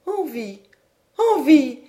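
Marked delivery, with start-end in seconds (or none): 1.3-1.8 taken aback. A narrator's reading. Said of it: "N'en avez-vous pas envie". («Envie?» plus fort, étonnée)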